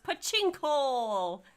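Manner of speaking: loud, slow, playful holler; drawn-out call. Pretend. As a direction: stretch it out high and loud. Viewer's joke called out.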